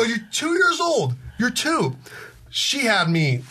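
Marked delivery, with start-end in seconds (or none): 0.0-2.0 high-pitched, drawn-out mock incredulity; exasperated, high retold protest; acted disbelief. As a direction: play up astonishment, pitch way up. Acted disbelief at toddler's remark.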